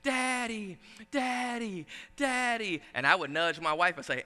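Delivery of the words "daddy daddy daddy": a child's call, lightly voiced and higher; drawn-out calls of a little girl; higher, sing-song daddy calls. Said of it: "she just started screaming".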